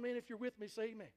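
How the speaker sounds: ordinary delivery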